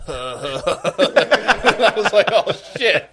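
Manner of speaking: high, stretched laugh through the words; laughing out the oh shit. Real, not acted. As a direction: crack up while telling it. Laughing while retelling shock.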